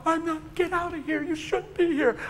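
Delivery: very high mimicked woman's voice; high, pleading acted protest. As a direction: imitate an upset woman pushing someone away. Voices the woman refusing him.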